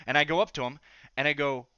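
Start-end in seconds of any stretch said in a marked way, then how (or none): none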